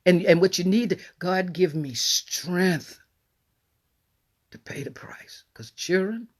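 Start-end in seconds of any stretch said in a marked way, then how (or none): 1.2-6.3 slow prayer sinking into a whisper; hushed plea for strength. Retold or imitated. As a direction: pray it slowly, then let it fall to a breathless whisper. Retold prayer, fading to whisper.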